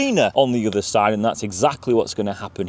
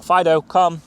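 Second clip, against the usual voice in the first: higher-pitched first recall call; calling the dog; 7 semitones higher, 4 dB louder than usual. Acted. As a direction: call your dog back, not yet desperate.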